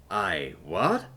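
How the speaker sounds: puzzled question with a big final rise; confused. A narrator's reading. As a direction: ask it baffled, swooping up at the end. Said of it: "he said, seeming confused".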